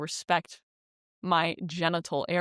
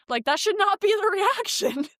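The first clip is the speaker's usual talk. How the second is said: high, loud disbelieving laugh through the words; laughing in disbelief; 13 semitones higher, 5 dB louder than usual. Real, not acted. Telling friends how absurd his reaction was, laughing in disbelief. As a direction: laugh it out, incredulous.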